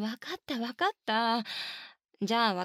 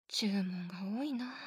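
whisper; 4 semitones lower, 7 dB quieter than usual. Real, not acted. Muttered aside to herself.

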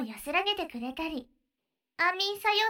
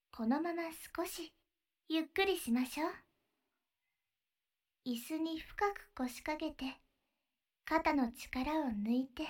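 guided breathing; 3 semitones lower, 7 dB quieter than usual. Acted. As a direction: lead the breathing gently, half whispered. Leads a breathing exercise.

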